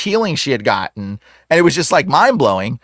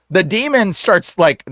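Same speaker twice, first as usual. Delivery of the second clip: ordinary talk, nothing marked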